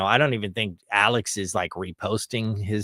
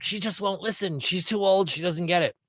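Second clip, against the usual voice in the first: lightly voiced higher kid complaint; frustrated kid; 8 semitones higher than usual. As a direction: imitate a child grumbling about mom. Voices his frustrated childhood self.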